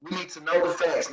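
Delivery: normal talking voice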